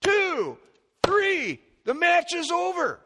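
high, loud slow count of a referee; referee's count. Retold or imitated. Preacher voicing a referee counting the pin. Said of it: "if the referee goes".

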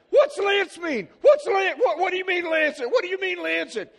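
put-on frantic high shrieking of a little boy; Michael. Son reacting to the doctor's word, panicked shrieking. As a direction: shout it over and over in a child's panic. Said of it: "Michael says".

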